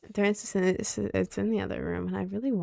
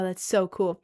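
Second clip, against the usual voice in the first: ordinary talk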